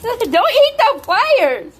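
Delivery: laughing yell. Real, not acted. Owner scolding her lizard for biting the pliers, laughing high yell. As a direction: laugh while scolding the pet.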